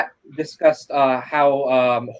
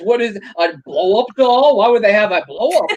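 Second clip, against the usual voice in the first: high-pitched mimicry of a baffled complainer; hammed-up, high incredulous questions; mock outrage; 6 semitones higher than usual. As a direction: imitate someone aghast at the decorations. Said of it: "all the crap you gave me".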